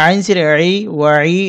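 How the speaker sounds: ordinary talk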